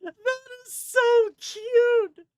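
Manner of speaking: high, drawn-out, loud squeal of delight. Real, not acted. Streamer gushing over the AI voice, in a high delighted squeal.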